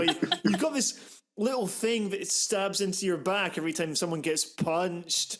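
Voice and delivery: ordinary delivery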